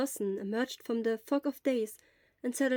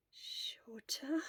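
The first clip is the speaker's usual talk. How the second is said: confused whisper; 4 semitones higher, 10 dB quieter than usual. Acted.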